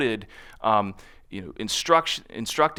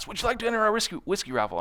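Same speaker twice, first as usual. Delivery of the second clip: higher, rushed patter that trips on whiskey; rapid-fire pitch; 10 semitones higher, faster than usual. Retold or imitated. [name] repeating the booth pitch, rushed. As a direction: blurt it out quickly. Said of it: "she can talk really, really fast".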